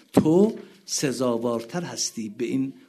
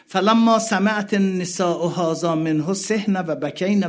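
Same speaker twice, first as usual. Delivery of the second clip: high, loud mourning recitation; impassioned preaching; 7 semitones higher, 8 dB louder than usual. Acted. Preacher crying out to the mourning gathering, impassioned. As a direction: cry it out to the mourners.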